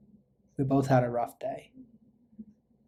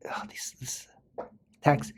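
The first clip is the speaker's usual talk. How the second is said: breathy whisper to himself, squinting at the text; whispered aside; 5 dB quieter than usual. Real, not acted. Teacher squinting at hard-to-read text, whispers. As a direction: whisper it under your breath, puzzled.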